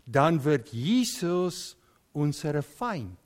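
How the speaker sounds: ordinary talk, nothing marked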